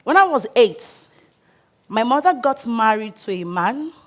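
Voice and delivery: ordinary talk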